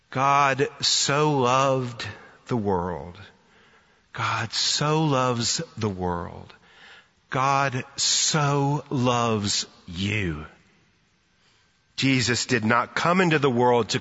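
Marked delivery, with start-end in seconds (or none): 0.1-10.4 very slow, each word drawn out; slow emphatic declaration. Real, not acted. Preacher tells listeners God loves them, slow solemn emphasis. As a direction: stretch it out slowly, word by word. Preacher slowly drives the verse home.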